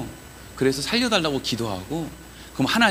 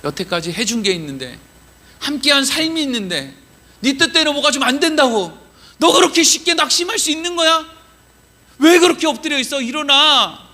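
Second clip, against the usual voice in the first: high, urgent pleading, building louder; earnest reproach; 9 semitones higher, 4 dB louder than usual. Acted. God urging Joshua to get up, impassioned high reproach. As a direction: rouse someone out of despair. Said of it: "하나님이 이러는 것 같다는 거죠".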